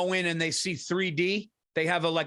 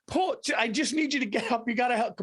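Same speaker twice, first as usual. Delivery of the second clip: higher-pitched pleading wife impression; imitating crying wife; 6 semitones higher than usual. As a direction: plead like a mock-tearful wife. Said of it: "standing over me the other day crying".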